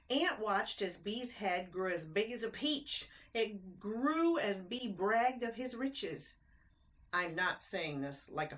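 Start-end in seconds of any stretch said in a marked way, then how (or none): 0.1-6.4 lilting rhymed storybook narration; bouncy read-aloud rhyme; reading to children. A narrator's reading. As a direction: read it like a bedtime picture book. Reads rhyming picture book aloud.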